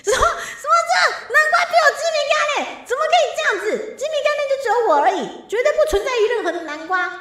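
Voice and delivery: high, loud, fast indignant outburst; mock outrage. Streamer protesting to chat that a pumpkin outranks her, mock outraged shout. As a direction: squawk in hammed-up disbelief.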